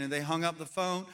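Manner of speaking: usual speaking voice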